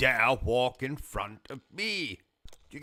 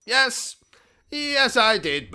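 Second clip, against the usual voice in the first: louder, higher indignant snap; indignant yes; 10 semitones higher, 6 dB louder than usual. Acted. Host snapping back at his sidekick, high and loud. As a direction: insist haughtily.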